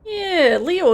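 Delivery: ordinary talk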